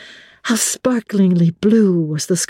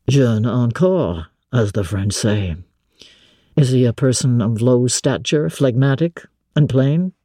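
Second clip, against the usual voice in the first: low male voice, scoffing; 6 semitones lower than usual. A narrator's reading. Master jealously mocking the cousin, low and scornful. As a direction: lower gentleman, jealous and mocking.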